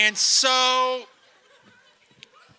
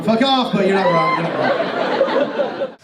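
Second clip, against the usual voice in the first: mock retort; 2 semitones lower, 7 dB louder, slower than usual. Comic answering the cop, mock shout turning to laughter. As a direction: snap back then give in. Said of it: "I was like".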